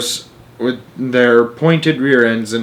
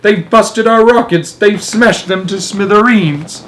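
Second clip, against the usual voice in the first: high, loud wail of alarm; desperate cry; 9 semitones higher, 4 dB louder than usual. A narrator's reading. Astronaut reporting wrecked rockets, crying out. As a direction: cry out the bad news. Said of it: "cried Showler".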